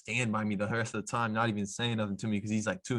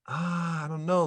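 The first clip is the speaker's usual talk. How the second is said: put-on unsure little kid; 7 semitones higher, slower than usual. Kid unsure about the NBA claim.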